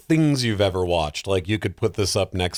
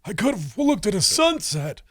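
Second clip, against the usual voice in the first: dying man's regret; 12 semitones higher than usual. Pretend. Joking about wasted hours, hammed-up deathbed lament much higher. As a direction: wail it like a dying man full of regret. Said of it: "on your deathbed going".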